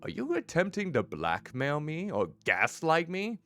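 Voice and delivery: normal talking voice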